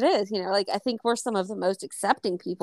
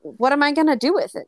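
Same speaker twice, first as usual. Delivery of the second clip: exasperated outburst; 6 semitones higher, 6 dB louder than usual. Retold or imitated. Retelling arguing with his mother about the missing drag, exasperated loud retort. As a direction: pitch jumps up and loud, fed-up retort.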